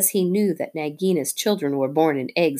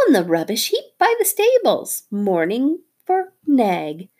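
fawning little bird; 11 semitones higher, 3 dB louder than usual. Acted. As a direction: coo like a bird praising its hero. Darzee the bird talking.

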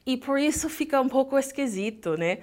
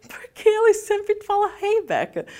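confused self; 11 semitones higher, 4 dB louder than usual. Retold or imitated. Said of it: "Eu fiquei".

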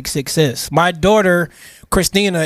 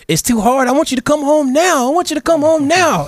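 put-on pitched well higher, retelling a tearful child; imitating crying daughter; 11 semitones higher than usual. Father retelling his daughter's tearful phone call, imitated in a higher voice. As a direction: echo the little girl's upset plea. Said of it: "she was crying".

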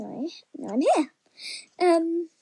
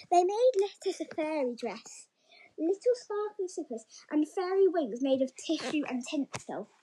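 plain reading voice

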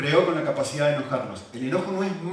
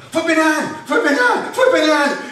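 very high, loud outraged football yell; shrieked fan protest in mock Argentine accent; imitated child's shouting; 13 semitones higher, 10 dB louder, slower than usual. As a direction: mimic a little boy protesting a penalty call. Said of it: "lo escuché gritando así".

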